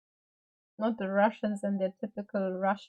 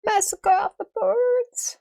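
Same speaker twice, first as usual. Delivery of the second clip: high, slow and louder, like a grim announcement; mock dramatic; 12 semitones higher, 6 dB louder, slower than usual. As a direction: proclaim it as a hammed-up tragedy. Dramatic quip about screaming birds.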